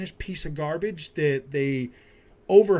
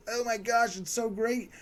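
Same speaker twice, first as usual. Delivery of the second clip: mock gushing fan; 5 semitones higher than usual. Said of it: "you're gonna be like".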